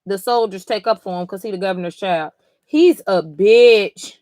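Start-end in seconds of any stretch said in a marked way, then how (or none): 2.6-4.1 loud slow insult. Real, not acted. Reacting to the governor's son, loud slow insult. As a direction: drag out he's a bitch loudly, slow.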